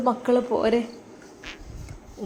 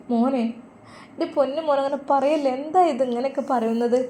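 anxious mother; 6 semitones higher than usual. Acted. Mother upset at son's talk.

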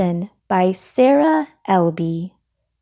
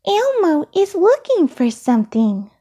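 high-pitched little puppet voice; high, childlike character voice; Elmo; 9 semitones higher, 3 dB louder than usual. A narrator's reading. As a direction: speak way up high as a small eager character. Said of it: "said Elmo".

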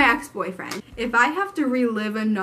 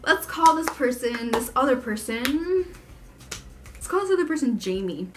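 normal talking voice

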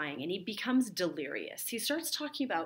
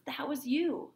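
raised, firm stage accusation pressed on you; insistent accusation; 8 semitones higher than usual. Acted. Teacher as Roxanne confronting Cyrano, pressing accusation. Lovers' scene, accusation repeated.

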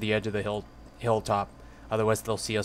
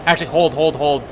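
loud urgent call to stop; urgent shout; 5 semitones higher, 8 dB louder than usual. Real, not acted. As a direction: shout to hold them back. Urgent halt call to squad.

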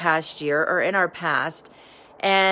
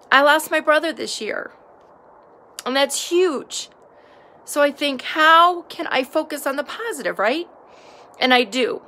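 emotional; 7 semitones higher than usual. Real, not acted. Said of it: "sorry I got all emotional".

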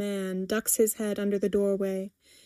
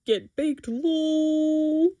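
lightly voiced teen aside; teen's murmur; 6 semitones higher, much slower than usual. A narrator's reading. Teen joking about baking, mock murmur. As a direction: stretch the last word, higher. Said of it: "murmurs one of the teens".

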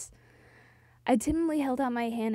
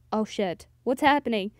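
ordinary talk

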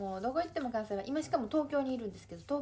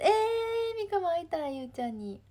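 high, loud drawn-out cry of wanting; eager longing; 6 semitones higher, 7 dB louder than usual. Real, not acted. Streamer told a friend wants to see her, eager. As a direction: burst out loudly, wishing you could join.

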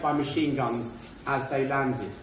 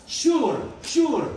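high, loud imitated shout, drawn out; Gene Hackman shouting; 11 semitones higher, 5 dB louder than usual. Lecturer imitating a film actor's command, shouted high. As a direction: call it out like a film officer. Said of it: "And Gene Hackman shouts".

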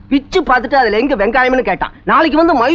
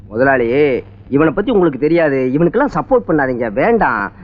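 much lower, earnest caution; low warning; 11 semitones lower than usual. Acted. Waiter warning the boss about this customer, low voice. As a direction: drop the voice right down to warn.

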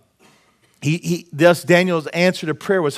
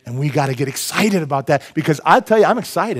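ordinary talk

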